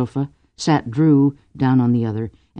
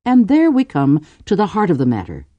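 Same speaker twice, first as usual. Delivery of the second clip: measured, higher opening; slowly, seriously; 6 semitones higher, 3 dB louder than usual. A narrator's reading. Cordelia gets to the heart of the matter, serious. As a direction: arrive at the point with care. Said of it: "Cordelia said slowly, seriously".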